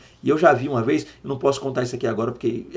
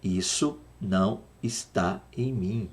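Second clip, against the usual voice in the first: slow reading; 4 semitones lower, 6 dB quieter, slower than usual. Shown as a demonstration. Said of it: "Olha aí".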